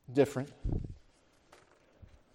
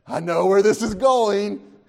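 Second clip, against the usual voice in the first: knowing disciple; 6 semitones higher, 9 dB louder, slower than usual. Acted. Disciple's inner recognition, acted.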